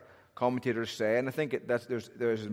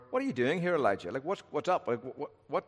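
casual questioning; 3 semitones higher, faster than usual. Shown as a demonstration. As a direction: toss it out lightly, pitched up and chatty. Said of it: "what tone of voice does God use?".

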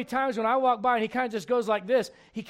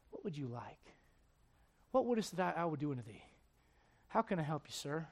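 much lower and softer, kneeling down to him; gentle and tender; 8 semitones lower, 14 dB quieter than usual. Acted. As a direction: speak kindly, close up. Jesus kneels, speaks to blind man.

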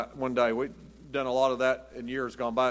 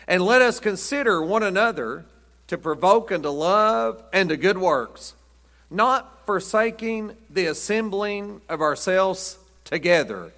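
scripture reading; 3 semitones higher, 3 dB louder than usual. Acted. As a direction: read the verse like it's holy. Reads scripture aloud.

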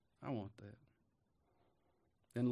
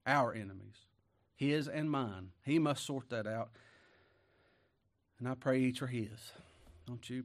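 ordinary talk, nothing marked

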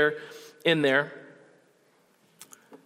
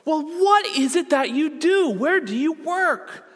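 high, louder, insistent amazement; imitated flabbergasted woman; 12 semitones higher, 4 dB louder than usual. Stunned woman who has to know his job, high astonished imitation. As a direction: plead to know, pitch right up. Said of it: "she is absolutely stunned and flabbergasted".

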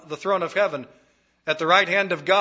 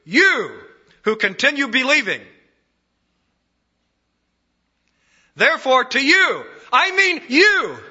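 YOU pushed much higher and louder; emphasis on you; 9 semitones higher, 8 dB louder than usual. Shown as a demonstration. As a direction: point straight at the listener on you. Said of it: "Doubly emphasized".

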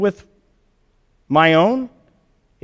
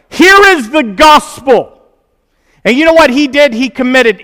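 preaching; 5 semitones higher, 5 dB louder than usual. Acted. Preaching climax.

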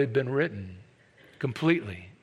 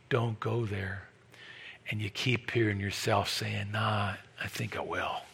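hushed intimate preaching; 8 semitones lower, 10 dB quieter than usual. Acted. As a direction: lean in and confess the stubborn answer quietly. Quiet dialogue of conscience replayed.

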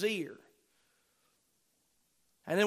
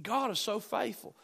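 ordinary talk

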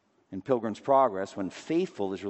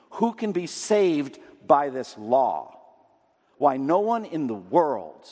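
raised, louder preaching voice; preaching read; 5 semitones higher, 6 dB louder than usual. Acted. Preacher reading a quoted sermon aloud to his congregation, in a raised preaching voice. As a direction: read the old sermon as if from the pulpit.

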